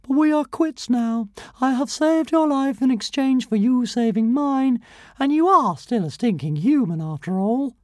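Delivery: a proud little dragon looking down on a human; haughty tiny dragon. A narrator's reading. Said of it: "said Zigarastica sorrowfully".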